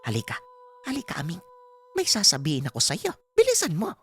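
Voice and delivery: nanay na tumatawag sa anak, pataas nang pataas ang boses; Aling Pasing. Acted. (boses ng matandang ina, mataas ang tono)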